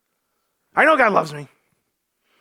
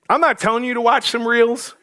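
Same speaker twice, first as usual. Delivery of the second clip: ordinary talk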